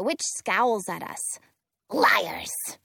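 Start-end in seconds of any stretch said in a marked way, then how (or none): 1.9-2.8 low angry snap; snapping. Acted. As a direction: spit the word out. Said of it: "she snaps".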